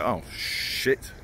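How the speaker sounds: under-the-breath whispered curse. Retold or imitated. Caught smoking by the police, whispered panic. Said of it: "I was like".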